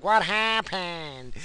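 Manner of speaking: high, very slow mom voice; imitating mom. Mom asking after the slammed door, high drawn-out imitation. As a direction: stretch it out like a worried mother. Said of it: "My mom's like".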